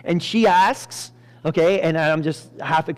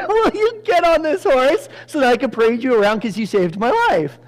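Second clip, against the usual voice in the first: high, loud mock-royal invitation; exuberant summons; 8 semitones higher, 5 dB louder than usual. King inviting Mordecai onto the horse, acted exuberance. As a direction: call it out with playful exuberance.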